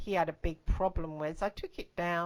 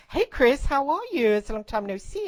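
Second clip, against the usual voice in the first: higher, much louder mock-hearty greeting; imitated caller, pitched up and loud; imitating Ken's phone greeting; 6 semitones higher, 7 dB louder than usual. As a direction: call it out like a caller greeting an old acquaintance. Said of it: "suddenly his voice was going".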